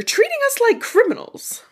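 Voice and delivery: put-on very high-pitched, affected Capitol accent. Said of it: "That was a pretty good Capitol accent".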